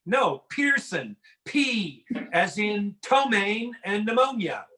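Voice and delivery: slow, deliberate spelling-out of Pearson. Retold or imitated. To the airline agent, slow deliberate spelling. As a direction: spell it out slowly, exasperated. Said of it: "I'm like".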